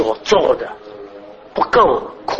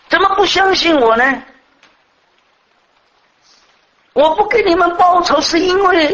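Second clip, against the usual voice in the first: voicing Joseph's hurt; 12 semitones higher than usual. Preacher voices Joseph's lament.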